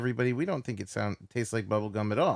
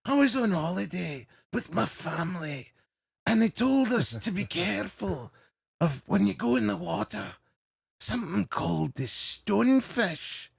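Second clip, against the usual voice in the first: higher, measured Scottish storyteller; Billy Connolly; 5 semitones higher than usual. Acted. Comedian recalling a holiday warning about stonefish, Scottish impression. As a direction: pause between phrases like a Scottish stand-up. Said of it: "Billy Connolly talk about the stonefish".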